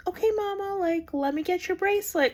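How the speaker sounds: high, bright-pitched nurse greeting; sweet nurse calling her mama; cheery nurse. Retold or imitated. Nurse greeting the new mom, chirpy and high.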